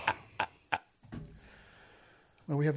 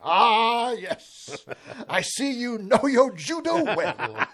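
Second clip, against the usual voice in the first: higher, slow, laughing line delivery; quoting a clip; 6 semitones higher, 4 dB louder, much slower than usual. Retold or imitated. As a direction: savour each word like a famous quote. Quoting the famous arrest clip.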